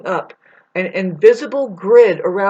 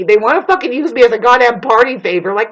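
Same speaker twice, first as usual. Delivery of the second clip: ordinary talk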